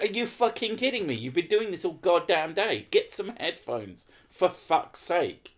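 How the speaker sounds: high, exasperated mock tirade; imagined rant. Imagined telling off the neighbour, exasperated. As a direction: let rip at her the way you wished you had. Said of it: "I just wanted to shake her".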